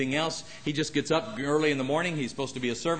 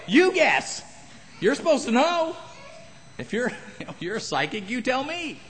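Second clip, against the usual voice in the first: teasing; 9 semitones higher than usual. Retold or imitated. Teasing a psychic hotline caller.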